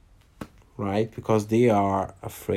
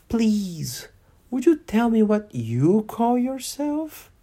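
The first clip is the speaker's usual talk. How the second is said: timidly; 11 semitones higher, slower than usual. Acted. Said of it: "she said timidly".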